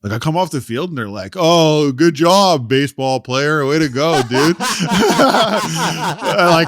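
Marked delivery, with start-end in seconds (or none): 1.4-4.6 loud, high sarcastic cheer; mocking taunt. Wife and mother-in-law mocking him off the field, loud sarcastic cheer. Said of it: "clowning me". 4.6-6.7 high laugh breaking into talk. Real, not acted. Laughing while telling how his family clowned him.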